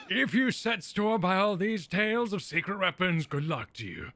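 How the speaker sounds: a hint of a weary old man; half-hearted old geezer voice. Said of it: "I took a guess at the old".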